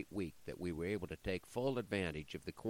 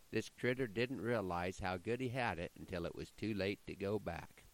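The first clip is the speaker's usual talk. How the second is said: ordinary delivery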